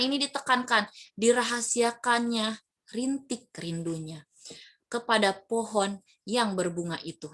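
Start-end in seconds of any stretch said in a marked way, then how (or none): 1.1-7.3 slow, "rintik" and "pohon" stressed; emphatic poem reading. Shown as a demonstration. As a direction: dwell on the key images. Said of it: "ada kata pohon, rintik".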